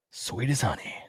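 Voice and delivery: whispered softly; a sudden hushed whisper. Acted. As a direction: breathe the words out in a whisper. Angel's words read aloud.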